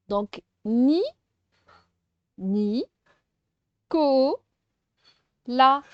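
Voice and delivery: teacher clapping out the syllables aloud; loud clapped syllables. Shown as a demonstration. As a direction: beat out every syllable firmly. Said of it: "on découpe oralement".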